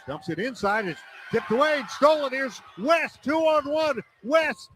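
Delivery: rising live basketball call, shouting the layup; play-by-play. Acted. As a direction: call the fast break live, building to a shout.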